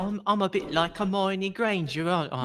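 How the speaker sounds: a hint of a prim British schoolgirl accent; mock posh British. American friend showing off her favorite accent, lightly British. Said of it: "Americans doing an impression of a British accent".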